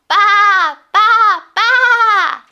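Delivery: loud, high bleating baa; sheep baa. Shown as a demonstration. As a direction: bleat it out with the kids. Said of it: "let's baa together".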